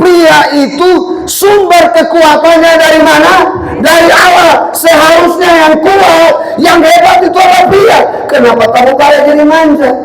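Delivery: high, loud, slow preaching shout; full-force preaching. Acted. Preacher challenging the men, shouting with full force. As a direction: thunder it at the congregation.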